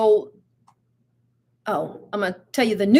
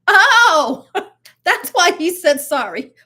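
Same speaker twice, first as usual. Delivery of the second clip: loud laughing oh, much higher; laughing realization; 7 semitones higher, 8 dB louder, slower than usual. Retold or imitated. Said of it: "we were like".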